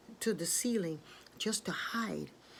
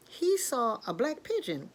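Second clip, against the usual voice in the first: ordinary delivery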